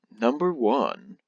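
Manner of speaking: slow, drawn-out countdown announcer. Pretend. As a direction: announce it like a top ten show. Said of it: "the way I said number one there was like an announcer".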